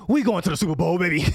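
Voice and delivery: high, loud screaming hype; shouting hype. Real, not acted. Fan screams to viewers about the Super Bowl, high and loud. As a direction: scream it like the team just won.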